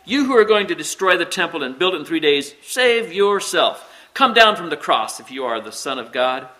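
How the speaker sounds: loud, high-pitched jeering taunt; hurled insults. A narrator's reading. Passers-by taunting the man on the cross, insulting. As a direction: hurl it at him, stretching the challenge. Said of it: "hurled insults at him".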